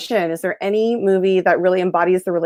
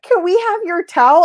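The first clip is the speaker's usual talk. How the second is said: much higher, loud eager asking; eager girls; 11 semitones higher, 4 dB louder than usual. Retold or imitated. Giddy girls asking the bagpiper for his towel. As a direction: beg like giddy fans.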